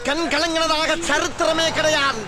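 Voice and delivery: high-pitched weepy insistence; tearful denial. Acted. Constable denying to colleague that he ever cries, tearful. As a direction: wail it proudly through tears. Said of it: "ஏன் ஏட்டையா அழுகுறீங்க".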